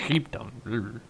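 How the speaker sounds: "Heap ton" whispered to himself; whispered repeat. Shown as a demonstration. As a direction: repeat "Heap ton" under your breath, amused at the odd phrase. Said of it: "Not sure why that came out like that".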